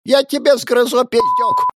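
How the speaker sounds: put-on very high, loud old woman screech; shrieking granny. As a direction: screech back like an outraged granny.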